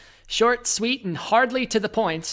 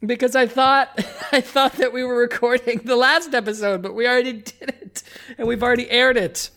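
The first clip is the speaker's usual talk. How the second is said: laughing; 6 semitones higher, 6 dB louder than usual. Real, not acted. Laughing at own mistake.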